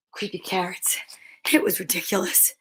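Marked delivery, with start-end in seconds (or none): 0.1-1.0 low, soft creepy carrots; muttered dismissal. A narrator's reading. Jasper brushing off his fear, muttered low. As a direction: mutter it under your breath.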